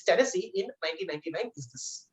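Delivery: ordinary delivery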